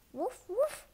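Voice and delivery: high yappy little dog woof; puppy bark. Acted. Storybook puppy barks to its owner, high woof.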